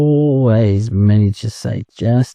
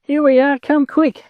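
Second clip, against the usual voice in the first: much higher calling out to mother; shouting together. Acted. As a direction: call across the river to mother. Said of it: "they shouted together".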